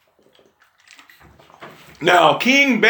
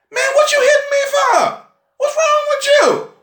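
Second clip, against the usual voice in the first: very high, indignant donkey squawk; high-pitched comic street-slang outburst; street-talking donkey. Acted. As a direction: protest as a wronged talking donkey. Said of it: "can I use street language".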